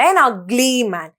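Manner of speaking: an ugly man said very slowly, the vowel stretched; slow demonstration. Shown as a demonstration. Teacher slowly models an before a vowel sound. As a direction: draw it out so the vowel sound is heard. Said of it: "ആ sound".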